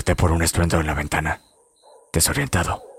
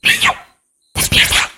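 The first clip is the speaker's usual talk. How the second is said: put-on eerie child's whisper from the dark; ghostly whisper; 5 dB louder than usual. A narrator's reading. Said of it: "fue la voz que resonó en mi cabeza".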